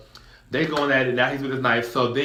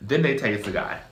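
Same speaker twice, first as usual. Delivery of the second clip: normal talking voice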